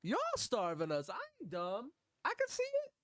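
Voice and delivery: put-on very high child's voice, indignant; angry little kid. Reacting to a hungry child in the video, very high kid voice. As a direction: squeak it out like an outraged kid. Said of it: "This little kid's like".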